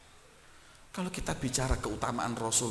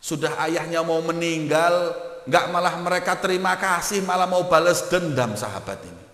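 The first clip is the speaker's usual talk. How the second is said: loud, high, indignant preaching; fervent preaching; 6 semitones higher, 9 dB louder than usual. Acted. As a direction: preach it at full force, outraged. (ceramah berapi-api penuh geram)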